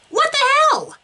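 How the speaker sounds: high, loud shocked yelp; sprayed with tea and shrieking; startled cry. Acted. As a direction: jump back in alarm.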